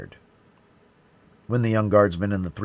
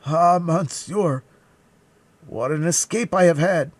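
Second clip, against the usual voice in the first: high, loud, drawn-out relief; terrified servant; 10 semitones higher, 7 dB louder, slower than usual. A narrator's reading. Planchet after escaping poison, high frightened wail. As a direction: wail your narrow escape to your master. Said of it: "more dead than alive".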